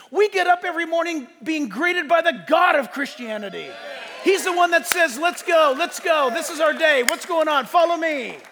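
rally the congregation, voice pitched high; impassioned preaching. Real, not acted. Preaching at full force.

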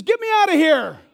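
acted desperate shout; crying out. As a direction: cry out loudly for rescue. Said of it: "One man cries out".

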